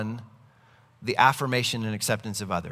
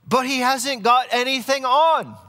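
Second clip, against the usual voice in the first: put-on very high, loud, drawn-out boy's cry with a British accent; little boy shouting; 11 semitones higher, 6 dB louder than usual. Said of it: "this one little boy just shouts out".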